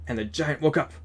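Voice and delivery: ordinary delivery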